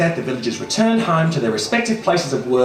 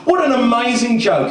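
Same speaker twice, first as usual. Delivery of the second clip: a touch higher, gleeful; delighted with his prank; 7 semitones higher, 4 dB louder than usual. Acted. Boy gloating over his prank, gleeful. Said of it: "he thought".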